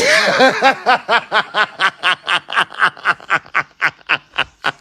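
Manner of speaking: long laugh. Real, not acted. Long laugh after joke.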